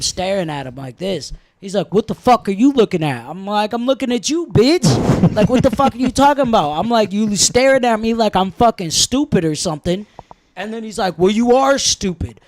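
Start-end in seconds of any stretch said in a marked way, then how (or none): 1.9-3.2 loud, higher retold challenge; mock guard yelling. 3.7-6.7 loud, higher, fast insult; retold yelling. Yelling back at the guard. 7.1-10.1 loud and higher accusation; retold angry jab. Retold argument with guard. 11.2-12.4 loud, higher retort; mock guard insult.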